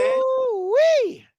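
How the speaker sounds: squealed high exclamation; amazed whoop, way up high; surprised ooh-wee pitched high. Real, not acted. Reacting to a tough schedule.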